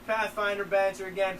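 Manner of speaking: usual speaking voice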